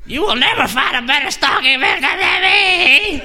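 high, crying shout; mock wailing ex. Jilted ex crying at the stalker, high and sobbing. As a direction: wail it through fake sobs.

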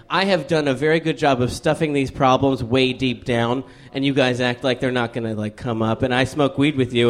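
ordinary delivery

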